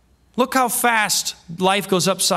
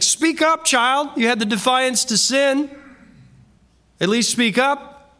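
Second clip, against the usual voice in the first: much higher, slow mock-stern scolding; a parent demanding the child speak up; hammed-up scolding parent; 9 semitones higher than usual.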